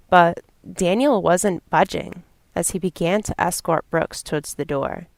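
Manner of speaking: usual speaking voice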